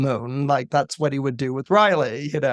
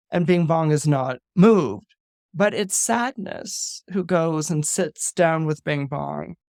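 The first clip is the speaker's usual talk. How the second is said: normal talking voice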